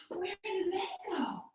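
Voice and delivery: whispered where did they go; mock-worried whisper. Parent pretending to look for monkeys, whispered. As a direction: hush them with pretend worry.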